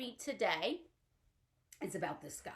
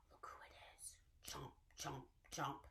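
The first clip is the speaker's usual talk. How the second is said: spooky whisper; 10 semitones lower, 18 dB quieter than usual. Acted. Creeping alligator reveal.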